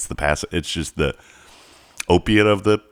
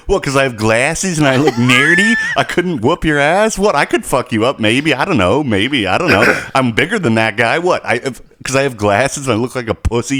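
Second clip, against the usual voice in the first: higher, heated mock outrage; indignant rant; 7 semitones higher than usual. Narrator fuming about the neighbor backing off the manager, heated rant. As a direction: vent like a wounded ego. Ranting about being disrespected.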